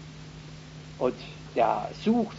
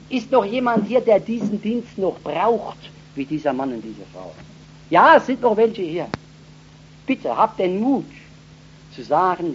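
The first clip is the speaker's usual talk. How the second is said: altar call; 7 semitones higher, 7 dB louder than usual. Acted. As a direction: call the undecided forward with urgency. (eindringlicher Aufruf, langsam und laut)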